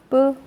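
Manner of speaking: 뿌 with a tight, unaspirated p; tense up the p in 뿌, no puff; tense consonant. Shown as a demonstration. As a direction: fortis p in 뿌. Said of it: "된 소리가 온다".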